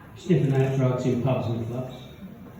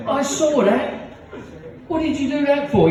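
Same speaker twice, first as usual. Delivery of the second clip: mum telling off; 13 semitones higher than usual. Retold or imitated. Mum scolding her boy after the slap, high and shrill-voiced. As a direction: squeal it out like a shocked mother. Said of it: "His mum goes".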